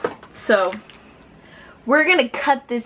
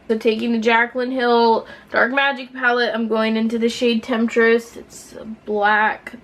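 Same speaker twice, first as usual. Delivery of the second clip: usual speaking voice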